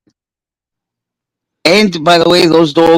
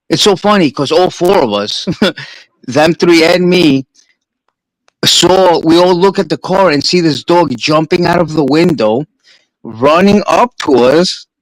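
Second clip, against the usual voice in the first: usual speaking voice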